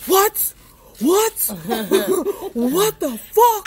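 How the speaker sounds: high, loud disbelieving what; shocked outburst. Retold or imitated. Man finds his account wiped, loud shocked disbelief. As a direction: shout in sudden disbelief. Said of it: "then he's like".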